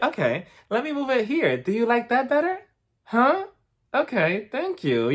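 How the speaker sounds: high-pitched, overly patient assistant voice; mock-patient helper. Event helper placating a control freak over cups, mock-patient. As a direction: imitate a helper straining to humour someone, pitched high. Lady placating a fussy Mary.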